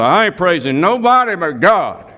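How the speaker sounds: a hint of a pious, self-righteous voice; mock super spiritual person. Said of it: "And they'll go".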